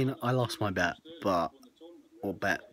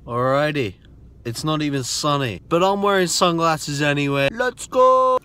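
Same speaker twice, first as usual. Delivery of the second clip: excited; 7 semitones higher, 13 dB louder than usual. Real, not acted. Energetic vlog intro outside.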